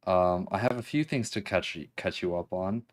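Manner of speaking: ordinary talk, nothing marked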